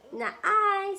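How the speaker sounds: high, loud "Not I"; goose refusing. Acted. Mother voicing the goose's refusal to her child, high and loud. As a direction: squawk a refusal.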